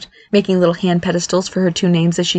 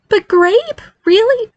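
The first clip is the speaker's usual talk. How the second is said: very high plaintive protest; moaning; 4 dB louder, much slower than usual. Acted. Barry protests his new fairy name, moaning. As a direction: moan the question in a high voice. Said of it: "Barry moaned".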